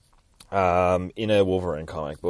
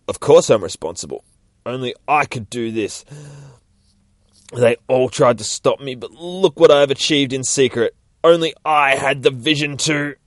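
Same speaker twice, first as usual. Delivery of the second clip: louder, boastful mad scientist; proud ranting; 4 semitones higher, 6 dB louder than usual. Acted. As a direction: declare it with pride. Doctor boasts about his work.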